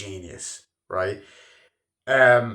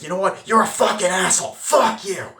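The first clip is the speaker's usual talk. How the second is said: loud, high shouted insults; furious yell; 9 semitones higher, 8 dB louder than usual. Retold or imitated. Retelling DiCaprio yelling at the phone operator, furious yell. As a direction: blow up at the man on the phone.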